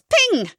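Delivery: very high, loud ping; magic ping. Acted. Comedian's fairy making magic, very high ping.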